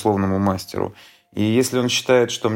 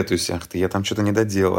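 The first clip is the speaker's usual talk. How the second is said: ordinary delivery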